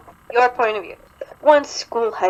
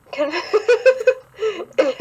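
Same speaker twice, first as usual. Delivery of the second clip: giggling through it; laughing; 9 semitones higher than usual. Real, not acted. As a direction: laugh while reading. Laughing at the fic.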